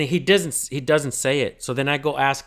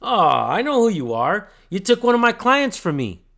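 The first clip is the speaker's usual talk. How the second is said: mocking impression, pitched up and loud; smug lawyer; 8 semitones higher, 6 dB louder than usual. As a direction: imitate a snooty, affronted attorney. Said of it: "Just in this tone".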